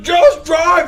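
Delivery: high, loud yell imitating the lady; acted-out shouting match; retold scream. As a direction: scream it as an order. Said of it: "We're just screaming at each other".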